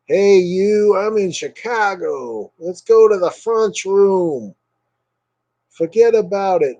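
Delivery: high, slow hammed-up Chicago gangster accent. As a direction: ham up a Chicago mobster accent. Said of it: "the Chicago gangster".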